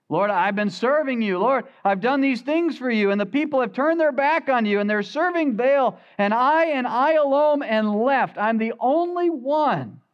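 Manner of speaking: self-pitying Elijah. Acted. Elijah pouring out his complaint to God, building and whiny. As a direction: plead your case, building in self-pity.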